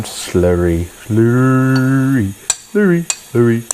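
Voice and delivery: slurry said over and over in silly voices; playful word repeat. Shown as a demonstration. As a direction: savour the word slurry, each time a new silly voice. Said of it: "That's a funny word".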